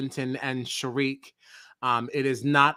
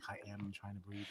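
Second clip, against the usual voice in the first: strained quiet quote; 5 semitones lower, 23 dB quieter than usual. Retold or imitated. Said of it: "He's like".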